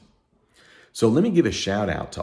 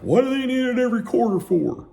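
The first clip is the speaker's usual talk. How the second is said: high complaining whine; mock a complaining client; high whiny complaint; 4 dB louder than usual. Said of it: "Clients, they're kinda complaining, like".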